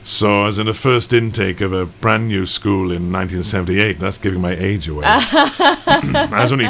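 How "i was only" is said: chuckling through the line, a little slower; a joke told while laughing. Real, not acted. Guest laughs while joking about his age to the host.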